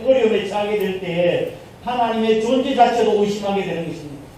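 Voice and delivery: ordinary talk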